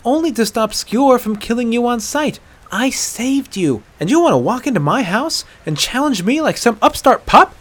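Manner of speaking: high, loud, scolding tirade; indignant outburst. Acted. Aela defending saving the hunter, indignant. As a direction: build to an outraged question.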